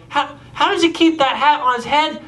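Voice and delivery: high, puzzled wondering; baffled question. Retold or imitated. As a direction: marvel at the mystery to a friend. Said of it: "I go".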